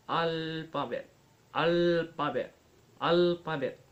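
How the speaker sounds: "alphabet" with a long, strong "Al"; stress on first syllable. Shown as a demonstration. Teacher showing stress pattern three, "alphabet" strong on Al. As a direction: hold and hit the Al of "alphabet", lighter on "pa". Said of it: "Al is stress".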